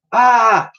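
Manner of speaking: high, loud startled scream. Acted. Elsie screams as she loses her footing. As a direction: yelp as she slips. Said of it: "she screamed".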